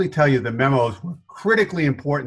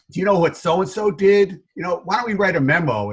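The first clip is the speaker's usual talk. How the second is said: lightly voiced brother, pitched up; imitating brother; 7 semitones higher than usual. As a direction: retell it as a higher-pitched, indignant-style proposal. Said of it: "my brother would say to me".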